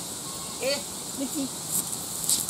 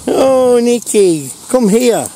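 higher and louder dog call; 7 semitones higher, 5 dB louder, slower than usual. Real, not acted. Owner calling the dog Nick, higher and louder. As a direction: sing out to the dog to come.

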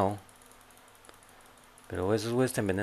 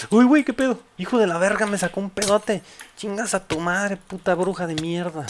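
loud, high cursing after a sudden blast; real startled shouting; 8 semitones higher, 7 dB louder than usual. Real, not acted. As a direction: yell it high and loud in shock. Creeper explodes on player.

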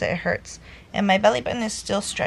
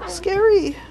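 scared; 9 semitones higher, 5 dB louder than usual. Real, not acted. Showing scar, frightened.

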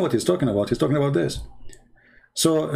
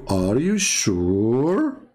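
slow mocking; much slower than usual.

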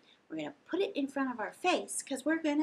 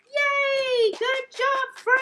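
cheer; 13 semitones higher, 9 dB louder, much slower than usual. Real, not acted. Cheering the kids after the song, high and loud. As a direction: shout praise up high.

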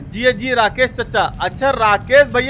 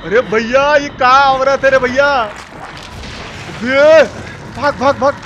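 loud, higher urgent shouts to run; frantic yelling; 5 semitones higher, 6 dB louder than usual. Real, not acted. Gamer yells at his character to flee, frantic shout. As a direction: holler at him to get moving.

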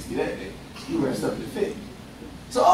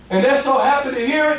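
ordinary talk, nothing marked

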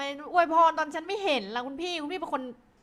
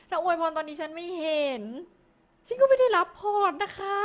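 higher and louder whiny complaint, building up; playful protest; 6 semitones higher, 6 dB louder than usual. Real, not acted. Complaining to the donor who wished her well while she wasn't looking, in a rising, whiny protest. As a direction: push the protest up until it squeals.

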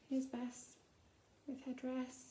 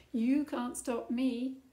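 defiant; 8 dB louder, slower than usual. Acted. Princess defies everyone.

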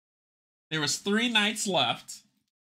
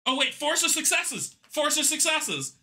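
sudden realization; 10 semitones higher than usual. Real, not acted. Realizes the dice roll wins.

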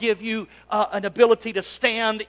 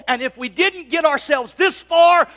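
loud, high preaching shout; 6 semitones higher, 5 dB louder than usual. Real, not acted. Pastor rallying the congregation, shouting. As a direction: shout it out to the church.

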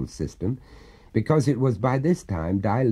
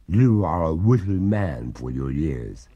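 slow, dry ghostly remark; 3 semitones lower than usual. Acted. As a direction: arch water ghost. Ghost replies.

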